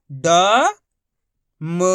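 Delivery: ड, म each held long as a separate syllable; letter by letter. Shown as a demonstration. Teacher sounding out each letter to be joined, letter by letter. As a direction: sound out ड, then म, pausing between. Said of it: "एक ही अक्षर का उच्चारण करके".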